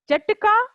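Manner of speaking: पाठः called out high with the final breath of the visarga; Sanskrit recitation. Shown as a demonstration. Teacher models Sanskrit word for pupils, called out high. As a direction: model पाठः high and clear for the class to echo. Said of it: "जैसे जैसे उच्चारण किया है".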